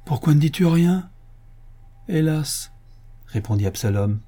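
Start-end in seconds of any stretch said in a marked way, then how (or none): 0.0-1.1 high, insistent question; impatient. A narrator's reading. Impatient wife demanding why her husband says nothing, insistent. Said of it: "demanda sa femme impatiente". 2.1-2.7 high, sighed word of woe; resigned lament. A narrator's reading. Absalom resigned to his fate answering his wife, mournful. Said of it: "soumis à son sort".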